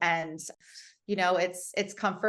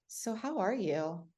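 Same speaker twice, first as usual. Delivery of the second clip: slow, soft and gently rising "So how are you?"; gentle, drawn-out "So how are you?" with a lift on "how"; caring tone; 5 dB quieter, slower than usual. Shown as a demonstration. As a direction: ask "So how are you?" slowly and softly, as if you really want to know. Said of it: "your tone and inflection of asking someone".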